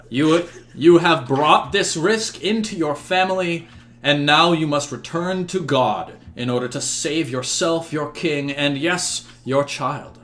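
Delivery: building, accusing sermon; fiery priest. Acted. Father Dewey admonishes King Uther before his court, fiery. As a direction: preach a warning at the king. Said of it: "he gives a fiery sermon".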